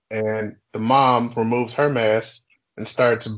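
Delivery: ordinary delivery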